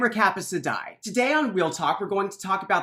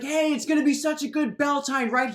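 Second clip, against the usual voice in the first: higher, half-hearted shout over the crowd; yelling over the noise, lightly hammed; mock shout; 8 semitones higher than usual. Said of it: "He's shouting".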